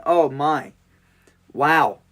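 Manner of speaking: normal talking voice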